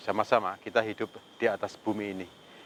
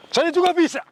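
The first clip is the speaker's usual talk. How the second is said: high, loud defiant cry; defiant shout; 8 dB louder than usual. Real, not acted. Ghost hunter shouting back at the spirit, defiant and loud. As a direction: fling it at the spirit. Confronting the spirit, sudden outburst.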